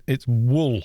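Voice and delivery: "wool" with a short rounded oo, firm fall; Welsh wool. Shown as a demonstration. Arguing with a friend over the word, insisting on "wool" the Welsh way. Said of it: "We do, it's wool".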